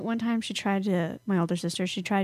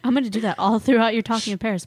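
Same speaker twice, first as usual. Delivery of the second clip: usual speaking voice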